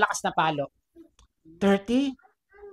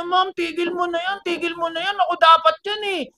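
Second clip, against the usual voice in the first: high begging, louder at the end; child pleading; 11 semitones higher, 6 dB louder than usual. Acted. Child begging the teacher to stop, high and desperate.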